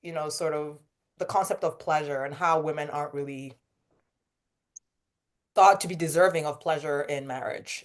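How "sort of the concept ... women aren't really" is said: ordinary talk, nothing marked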